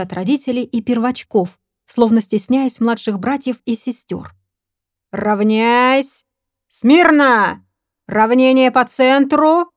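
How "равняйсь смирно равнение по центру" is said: barked parade commands; shouting drill sergeant. Acted. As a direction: drill commands, loud and drawn out. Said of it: "командным басом начал преподаватель по труду".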